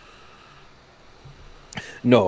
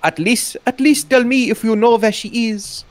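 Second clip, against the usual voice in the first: desperate pleading; 9 semitones higher than usual. Acted. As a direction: beg with rising desperation. Husband begs.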